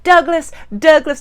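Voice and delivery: high, loud imitation of hollering for someone; mock calling out. Shannon bursting into the house looking for Douglas, hollering. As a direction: holler the name through the house, playfully imitated.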